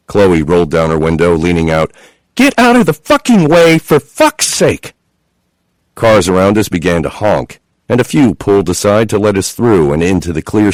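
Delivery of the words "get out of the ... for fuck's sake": high, loud furious scream, slowing at the end; screaming at traffic. A narrator's reading. Chloe leaning out the window screaming at traffic. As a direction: scream it at the cars blocking the road.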